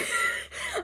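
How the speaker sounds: very high, soft laugh; embarrassed laugh. Real, not acted. Laughing after mentioning she started crying.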